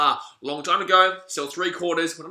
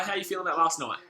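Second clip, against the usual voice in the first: ordinary talk, nothing marked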